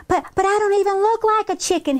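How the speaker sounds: high, quick stammered protest; arguing. A narrator's reading. Henrietta to her sisters, arguing she's no chicken. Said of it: "Henrietta argued".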